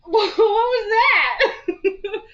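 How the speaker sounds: high, loud startled cry. Real, not acted. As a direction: jump at a sudden noise. Startled by a sudden noise.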